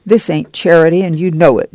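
plain reading voice

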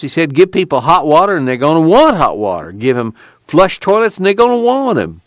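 animated Okie storyteller, pitched high; indignant tubby man. Acted. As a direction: swap camp stories with rising indignation.

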